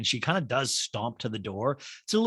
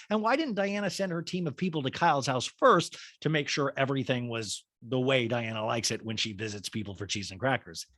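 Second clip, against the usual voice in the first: usual speaking voice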